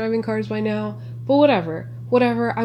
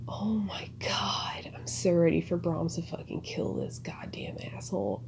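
whisper; 3 semitones lower, 12 dB quieter than usual. Real, not acted. Whispering to the viewers while watching the horror film. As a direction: hush it like you don't want the film to hear.

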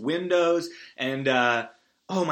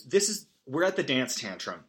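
usual speaking voice

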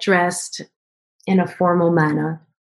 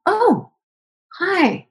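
surprised; 12 semitones higher than usual. Retold or imitated. Caller turns out to be Karma.

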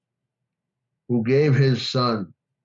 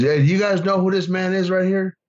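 much higher, calling out over an audience; announcing to the crowd; 8 semitones higher than usual. Retold or imitated. Speaker on stage calling to the crowd, raised and bright. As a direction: present your friend to the whole room. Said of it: "he says".

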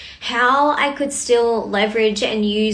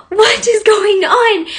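exasperated; 9 semitones higher, 6 dB louder, slower than usual. Retold or imitated. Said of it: "And I was like".

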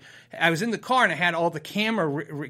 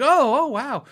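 high, loud imitated amazement; mimicked barista's wowed reaction; impressed; 8 semitones higher, 6 dB louder, slower than usual. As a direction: react as if wowed by a big number. Imitates barista impressed by subscriber count.

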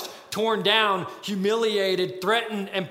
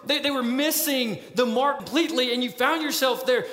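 ordinary talk

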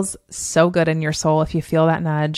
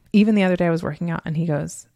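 ordinary delivery

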